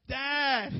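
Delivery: loud high call to dad from bed; child shouting for his father; kid calling out. Retold or imitated. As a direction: yell for dad from the bedroom. Kid calling third time.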